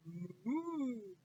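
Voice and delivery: a cow's moo. Acted. The cow answering the witch with a moo.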